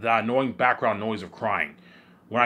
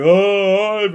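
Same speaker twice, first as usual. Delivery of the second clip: high, loud mock wail; crying imitation; 8 semitones higher, 8 dB louder, slower than usual. Acting out V J crying for Ivan, mock wail. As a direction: draw out a loud put-on cry. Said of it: "V J's crying".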